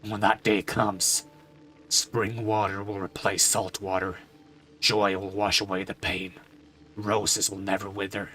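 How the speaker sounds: a weary, grieving father; somber father voice. Acted. Father character speaks again.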